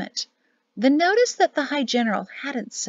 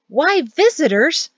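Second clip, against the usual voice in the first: high, slow, loud bewilderment; drawn-out raised-voice question; loud exchange; 7 semitones higher, 6 dB louder than usual. A narrator's reading. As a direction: call it out, baffled. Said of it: "After some loud exchanges of".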